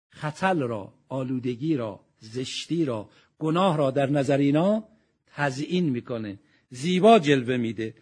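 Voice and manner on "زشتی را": ordinary talk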